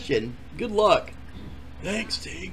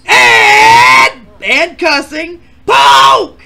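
loud intro; 4 semitones higher, 12 dB louder, much slower than usual. Acted. Announcer introducing contestant.

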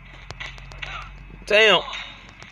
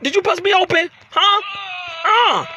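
high-pitched indignant demand; worked-up, incredulous outrage; outraged confrontation; 9 semitones higher than usual. Pretend. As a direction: challenge the rival in high disbelief. Confronting rival after being hurt.

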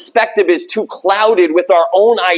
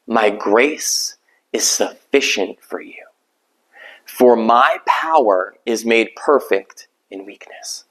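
voice of the Lord; 7 semitones lower, slower than usual. Acted. Quoting the Lord in a sermon.

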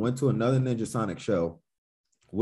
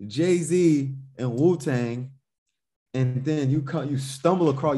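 ordinary talk

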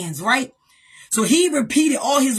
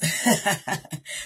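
short low chuckle; brief real chuckle; real laugh; 6 semitones lower, 7 dB quieter than usual. Real, not acted. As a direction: laugh softly at the joke. Laughs at own nevertheless.

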